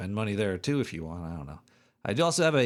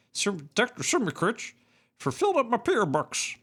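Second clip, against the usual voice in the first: much higher, squeaky thanks; high silly voice; 11 semitones higher than usual. Pretend. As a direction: pitch it way up like a cartoon.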